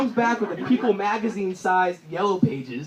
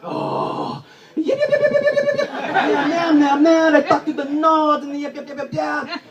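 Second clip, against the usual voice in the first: high, loud yep yep chant; mock party yelps; 8 semitones higher, 7 dB louder than usual. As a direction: squeal yep over and over. Frenchman mimicking party chatter.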